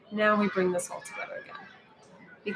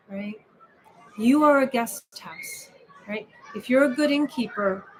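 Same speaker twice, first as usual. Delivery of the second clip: ordinary talk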